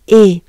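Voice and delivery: loud, tight é; French é. Shown as a demonstration. Teacher contrasting é with the nasal e. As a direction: say é with a wide smile. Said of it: "different than the E".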